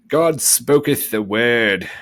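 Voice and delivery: grand biblical voice. Acted. Quoting God's word to viewers, in a slow booming voice. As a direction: intone it like scripture. Mock scripture quote.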